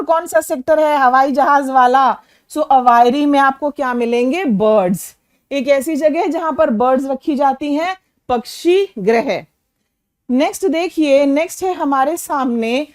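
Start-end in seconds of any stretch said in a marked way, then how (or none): none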